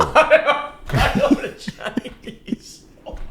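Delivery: high, slow repeat through laughter; laughing mimicry. Host repeating co-host's impression, high and helpless with laughter. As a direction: wheeze it out, cracking up.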